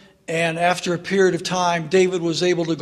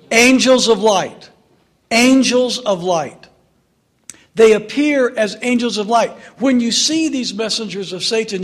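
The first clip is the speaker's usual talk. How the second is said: louder, higher declaration; preaching emphasis; 4 semitones higher, 5 dB louder than usual. Real, not acted. Preacher proclaiming the answer to the congregation, loud and emphatic. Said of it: "preacher drives answer home".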